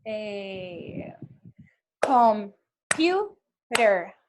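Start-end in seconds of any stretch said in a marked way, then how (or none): 2.0-4.1 "computer" said very slowly, each syllable pulled apart; syllable demo. Shown as a demonstration. Teacher clapping out "computer" syllables for students. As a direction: stretch out "computer" syllable by syllable, louder. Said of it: "Por ejemplo".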